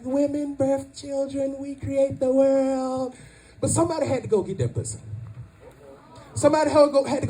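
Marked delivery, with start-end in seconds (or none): 0.0-3.2 higher, slow and stretched out; mock women's boasting. Comic mimics women claiming credit, slow and high. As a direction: intone it slowly like a grand proclamation. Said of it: "Y'all be like".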